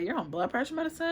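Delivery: a friend who can't believe it; shocked friend. Retold or imitated. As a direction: friend at dinner, fast and disbelieving. Friend shocked at her medication.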